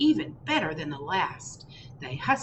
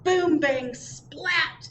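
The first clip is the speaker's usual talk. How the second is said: dramatic crash words; 9 semitones higher, 5 dB louder, much slower than usual. A narrator's reading. Cake crashing in story.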